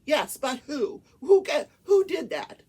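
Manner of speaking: loud, high-pitched interrogation; retold angry demand. Judge demanding who found the screenshots, mock angry. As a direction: imitate an exasperated judge pressing for an answer.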